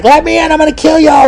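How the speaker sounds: high-pitched hammed-up threat; shriek-like falsetto parody of a jilted lover; mock crazed mistress. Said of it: "And she's like".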